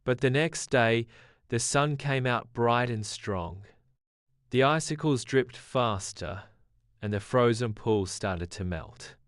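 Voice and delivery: ordinary delivery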